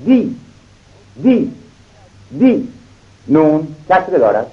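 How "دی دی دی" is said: دی said clearly, rising high on repeats; syllable دی repeated. Shown as a demonstration. Teacher sounding out دی for pupils. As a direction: sound out دی slowly for the class.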